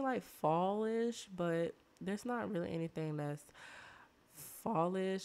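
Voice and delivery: usual speaking voice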